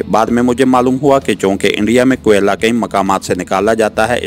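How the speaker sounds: usual speaking voice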